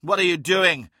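demanding. A narrator's reading. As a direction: confront him hard. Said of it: "demanded Hathor Mart".

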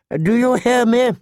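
high-pitched, insistent governess call; insistent septa. A narrator's reading. Septa knocks at the sleeping girl's door, insistent. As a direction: demand an answer through the door.

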